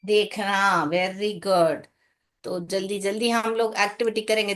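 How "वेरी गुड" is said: ordinary delivery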